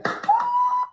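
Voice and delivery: sharp, very high imitated gasp of a stunned room. French audience standing up for her, imitated gasp. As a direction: gasp like a whole crowd caught off guard.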